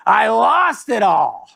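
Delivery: high, slow deadpan punchline through a laugh; imitating Norm. Sandler giving Norm's punchline, high and slow. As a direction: deliver the loss like it's nothing. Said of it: "He goes".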